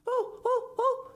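very high, wailing oh; wife sobbing at her wings; sobbed. Acted. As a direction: wail it like a sob. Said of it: "sobbed Missus Gregg".

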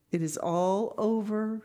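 Anne, tragic. Acted. Anne returns after the romantic parting, tragic announcement. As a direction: announce it as the end of everything.

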